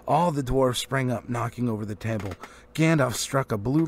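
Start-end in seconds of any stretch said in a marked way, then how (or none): none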